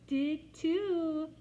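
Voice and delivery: put-on high, loud cheeky child; defiant little girl. A narrator's reading. Jenny admitting the puddle, high defiant. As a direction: answer back proudly.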